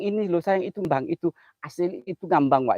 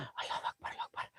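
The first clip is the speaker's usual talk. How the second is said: hushed frightened takbir; whispered prayer; 18 dB quieter than usual. Real, not acted. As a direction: breathe the prayer out silently.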